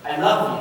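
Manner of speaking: "I love you." lifted at the end like a question. Shown as a demonstration. Man showing how a hesitant confession sounds, questioning. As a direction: let "I love you." rise, unsure. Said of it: "you basically phrase it as a question".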